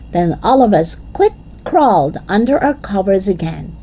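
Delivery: usual speaking voice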